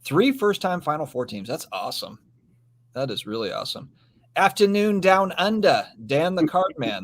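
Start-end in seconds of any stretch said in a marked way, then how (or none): 4.4-7.0 bad Aussie accent. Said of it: "the best Aussie accent I can come up with".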